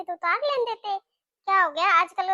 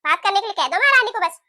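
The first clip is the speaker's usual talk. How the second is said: scolding; 2 semitones higher, 6 dB louder, faster than usual. Acted. Mother-in-law scolding the new bahu to fetch water. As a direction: order her off sharply.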